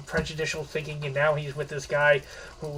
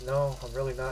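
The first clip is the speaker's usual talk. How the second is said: a touch of sadness, softly admitting it; lightly voiced regret, a mild sad tone; 4 semitones lower, 6 dB quieter than usual. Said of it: "he says it in a kind of sad way".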